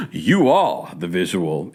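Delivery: slow, pointed mock-diva delivery; lightly imitated grand star, stretched words. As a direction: draw it out like a star making a pronouncement. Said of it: "She's gonna be like".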